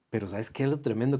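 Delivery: normal talking voice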